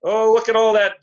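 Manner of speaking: ordinary talk, nothing marked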